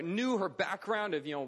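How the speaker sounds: usual speaking voice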